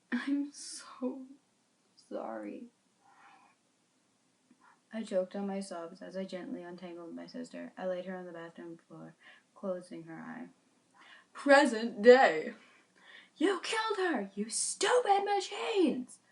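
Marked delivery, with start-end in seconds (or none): 0.1-2.7 broken whispered apology; tearful whisper. Acted. 11.3-12.5 dramatic announcement. A narrator's reading. Dramatic time-skip announcement. 13.4-16.2 high, strained furious cry; angry yell. Acted. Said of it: "I yelled".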